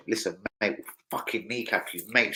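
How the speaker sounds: soft, low menace; hushed mock gangster threat; mock menacing threat. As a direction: lean in and threaten quietly. Acting out Napoli's threat to player.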